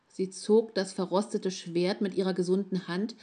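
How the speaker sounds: ordinary delivery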